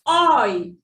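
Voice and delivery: "oi" loud, gliding from aw to ee; oi sound demo. Shown as a demonstration. Teacher introducing the next sound, "oi" loud and drawn out. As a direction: stretch "oi" into one strong falling glide. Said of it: "Then the next sound is".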